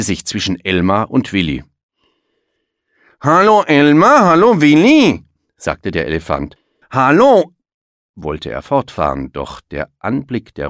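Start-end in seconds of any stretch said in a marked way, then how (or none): 3.2-5.2 much higher and louder, a big hearty hello; booming greeting. A narrator's reading. The strange elephant greets Elmar and Willi, loud and high. 6.9-7.5 much higher and louder, a big hearty hello; booming greeting. A narrator's reading. The strange elephant greets Elmar and Willi, loud and high.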